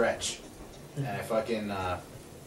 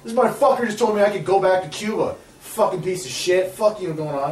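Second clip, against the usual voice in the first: high, loud, indignant tattling; retold pissed-off complaint; 6 semitones higher, 6 dB louder than usual. Handcuffed teen complaining to another cop, angrily retold. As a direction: protest heatedly, as in a retold outburst. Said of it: "all fucking pissed off".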